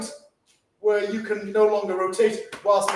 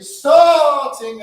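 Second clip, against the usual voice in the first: STARTING stretched long and loud; drawn-out STARTING; 3 semitones higher, 11 dB louder than usual. Shown as a demonstration. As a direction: hold the word STARTING as the move travels. Stretches word while showing the move.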